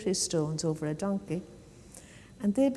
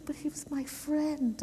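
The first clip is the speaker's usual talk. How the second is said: high, soft protest; lightly acted, quiet hurt; upset; 8 semitones higher, 5 dB quieter than usual. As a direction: plead softly, higher, a touch hurt. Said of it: "he was really upset about it".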